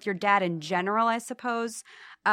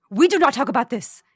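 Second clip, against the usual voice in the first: stern dad; 7 dB louder, faster than usual. Retold or imitated. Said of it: "he said".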